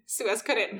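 plain reading voice

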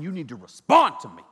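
RESPOND punched loud; mock-stern command with a burst on "respond"; stern demand. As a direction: hit "respond" hard as an order. Acted stern demand to wife.

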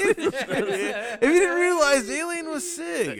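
high, drawn-out cry through laughter; loud, laughing squeal. Real, not acted. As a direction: crack up while getting the joke out. Cracking up at the alien joke.

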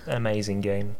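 ordinary delivery

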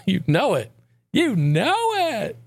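sing-song you know it. Acted. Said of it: "echoed catchphrase, pitched up, slow".

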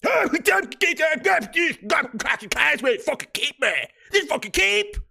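put-on high, loud, garbled Cape Breton accent; hammed-up Cape Breton rant, barely words. A Cape Bretoner griping about the causeway, garbled Cape Breton accent. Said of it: "you go to parts of Cape Breton and it's not even English".

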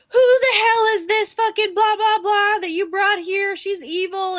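high, loud retold outrage; indignant grandma. Grandma objecting to the girl he brought, indignant. Retelling grandma's angry outburst.

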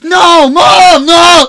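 very high, loud wail; reenacted childish shriek; imitated panicked scream. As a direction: scream for Mom like a cornered kid. Reenacting childhood bathroom fight.

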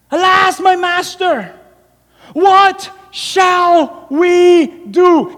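high, loud, very slow cry of alarm; panicked servant. Acted. Frightened servant crying out to his master Elisha. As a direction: wail it like a terrified young servant. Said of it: "notice the exclamation point".

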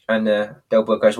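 ordinary delivery

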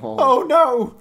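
high, loud pleading innkeeper; humble cry. A narrator's reading. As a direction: beg for mercy in a high voice. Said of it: "cried the host quite humbly".

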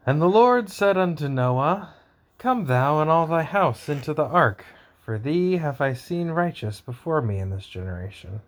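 high, slow, solemn scripture voice; booming biblical narration. Acted. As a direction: proclaim it like a preacher. Solemn scripture reading, louder and higher.

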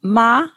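"ma" said high and level; first tone on "ma"; Mandarin tone. Shown as a demonstration. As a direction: hold "ma" high and flat. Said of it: "So the word".

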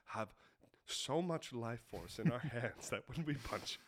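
very low, soft mock lecturer; deadpan teacher voice, measured with pauses; solemn class instructor. Instructor addresses class on explosive punching, solemn low mock lecture.

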